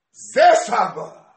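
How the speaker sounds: triumphant shout. Retold or imitated. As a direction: shout it like a child's big reveal. Child surprises grandfather from behind curtain. (צעקת ניצחון של ילד)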